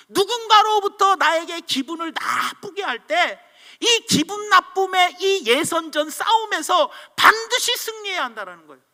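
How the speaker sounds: ordinary talk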